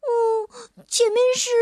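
high trembling hesitant voice; frightened whimper. Acted. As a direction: dread at what's ahead. Little dinosaur looks up in dread. (害怕地迟疑)

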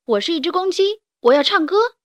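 high, loud proclamation; proud declaration. Acted. Young rooster declaring he will be a singer, proud declaration.

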